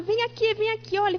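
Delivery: calling out. Retold or imitated. Daughter calls her father over.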